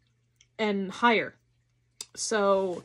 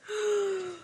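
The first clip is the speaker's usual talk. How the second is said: excited gasp; 10 semitones higher than usual. Real, not acted. Gasp at the wallet.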